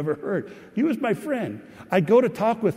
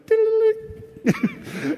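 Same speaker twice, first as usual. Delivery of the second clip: a sudden high-pitched mock noise at the keyboard; playful high squeal. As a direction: squeak high to tease a friend. Sneaks up to the keyboard.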